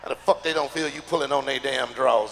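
usual speaking voice

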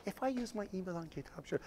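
very soft, swallowed mumble; timid, barely audible question; mumbled. Shown as a demonstration. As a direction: mumble it as if you don't want to be heard. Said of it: "Not, not like".

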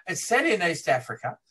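ordinary talk

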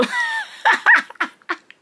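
high shrieking laugh; loud burst of laughter. Real, not acted. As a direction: cackle out loud at yourself.

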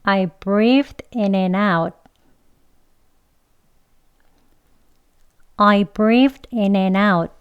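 very slow, linked model sentence; 천천히. Shown as a demonstration. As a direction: take it slowly, word by word for learners. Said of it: "천천히 두 번 해볼게요". (천천히 또박또박)

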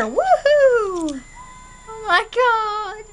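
high, loud woohoo then slow gasp; on a roller coaster. Pretend. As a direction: whoop like on a drop. Coaster ride thrill.

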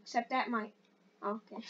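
ordinary talk, nothing marked

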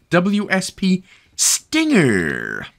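slow, drawn-out showy weapon reveal; hammed-up announcement. Host revealing the weapon to grab, hammed-up announcement. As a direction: announce it grandly, stretching the last word.

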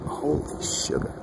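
slow breathy whisper of awe; awed whisper. Real, not acted. Vlogger whispers in awe at the face in the sky.

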